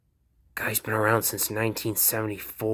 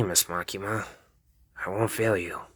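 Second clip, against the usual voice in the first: normal talking voice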